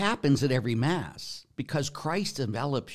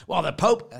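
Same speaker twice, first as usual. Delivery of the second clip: raised, louder mock objection; lightly hammed-up grumble at higher pitch; mimicked complaint; 7 semitones higher, 6 dB louder than usual. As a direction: imitate a protesting critic. Mimics critics of the Pope.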